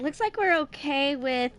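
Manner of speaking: normal talking voice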